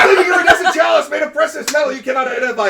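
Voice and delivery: high, loud, fast laughing recital; laughing shout. Real, not acted. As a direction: shout the description through laughter. GM repeats description, shouting.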